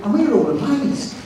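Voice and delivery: ordinary talk, nothing marked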